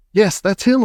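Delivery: high, loud excitement; excited yell. A narrator's reading. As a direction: call it out gladly. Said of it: "yelled Mister Wonka".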